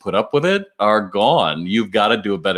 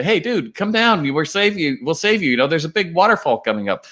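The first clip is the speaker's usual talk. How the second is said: much higher, pressing call to come down; mock urgent yell from a speedboat; 8 semitones higher than usual. Speedboat crew urges the man before the waterfall, much higher urgent call.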